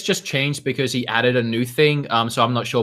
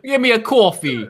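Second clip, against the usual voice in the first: raised, put-on New York accent; 9 semitones higher than usual. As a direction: order it like a New Yorker. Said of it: "a thick New York accent".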